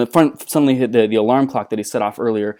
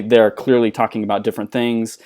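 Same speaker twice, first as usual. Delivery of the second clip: ordinary delivery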